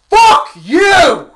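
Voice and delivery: very high, very loud furious yell; full-force yell. Retold or imitated. Employee yelling at his boss up close, full-force yell. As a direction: yell it right in his face. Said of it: "And I yelled it".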